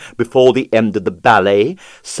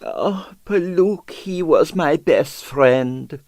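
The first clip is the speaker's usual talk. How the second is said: softer, slow sighing lament; 5 semitones higher, 3 dB quieter, slower than usual. Acted. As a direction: sigh out a mock mourning. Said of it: "Pavel sighed".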